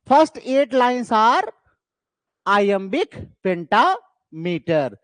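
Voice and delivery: each word drawn out very slowly; slow dictation. Shown as a demonstration. Teacher slowly dictating the key definition to students. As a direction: spell it out slowly so students can note it down. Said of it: "तो याद रखिएगा".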